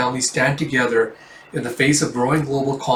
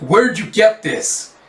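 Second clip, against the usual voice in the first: slow, higher, pressing question; suspicious demand; 8 semitones higher, 3 dB louder, slower than usual. Acted. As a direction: lean in and demand an answer. Officer demands about coin.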